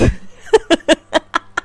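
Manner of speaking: high-pitched amused laugh; real laugh. Real, not acted. Laughs at conspiracy about her.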